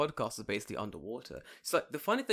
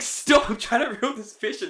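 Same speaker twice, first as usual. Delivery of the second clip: high, loud mock-pleading yell; loud retold protest under pressure; exasperated protest; 11 semitones higher, 12 dB louder than usual. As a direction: shout back in mock exasperation. Acting out own protest to friends.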